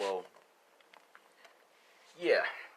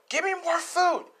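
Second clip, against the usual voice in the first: demanding shout; 4 dB louder than usual. Acted. Pregnant mother demands food.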